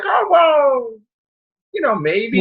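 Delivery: mock high-pitched hopeful wife. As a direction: lift the voice way up, sweetly hedging. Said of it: "the wife was like".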